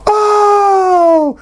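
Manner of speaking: sharp gasp into a high startled oh; shocked gasp. Retold or imitated. First reading the twist, a sharp shocked gasp. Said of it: "the first time I read that, I was like".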